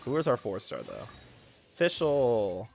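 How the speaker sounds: ordinary talk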